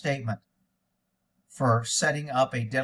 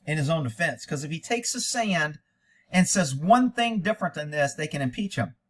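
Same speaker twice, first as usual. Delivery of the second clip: normal talking voice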